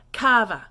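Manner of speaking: loud kava, falling; French ça va. Shown as a demonstration. Teacher modelling how are you in French. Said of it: "you're gonna say".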